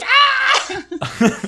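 very high, loud shriek; terrified scream. Acted. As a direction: scream as if ducking for cover, hammed up. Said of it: "he ducks and covers".

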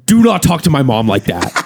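a mild mock yell, half laughing; retold yell. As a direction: replay the yell at the guy, lightly. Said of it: "I just yelled".

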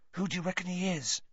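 high, quick, hushed hiss; urgent stage whisper; hissed question. A narrator's reading. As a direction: hiss it softly to friends. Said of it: "Ron hissed".

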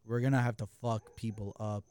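low, calm imitation of a friend's warning; super calm. Driver warning that somebody might not make it out, super calm. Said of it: "like, super calm".

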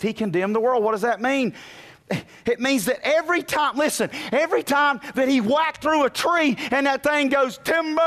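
high, loud sermon cry; soaring pulpit preaching; full-force preaching. Acted. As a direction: preach it out to the rafters.

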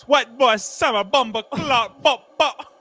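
an over-the-top imitation of a Jamaican accent; mock Jamaican accent. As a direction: ham up a put-on Jamaican accent. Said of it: "talks in a Jamaican accent all day".

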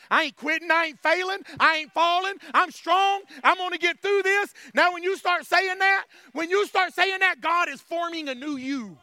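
rising, driving run of declarations; fervent preaching. Real, not acted. Preacher declaring strength through hell, fervent preaching.

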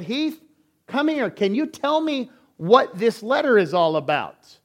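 put-on high, loud call to a child, then a firm question; calling out to her son. Wife calls son over letter.

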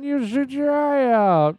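high, slow sing-song warning; drawn-out, high-pitched scold; movie quote voice. Retold or imitated. Quoting A Christmas Story mom.

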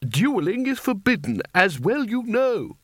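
high-pitched, indignant reproof; prim objection. Acted. Spelter reminds wizards of the rule, high and reproving. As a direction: forbid it in a high, scolding voice.

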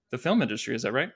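normal talking voice

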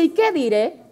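"¿Y qué diré?" leído como pregunta; entonación de pregunta. Retold or imitated. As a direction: deja "diré" en el aire, subiendo al final. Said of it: "es una pregunta, ¿verdad?". (lectura interrogativa de "¿Y qué diré?")

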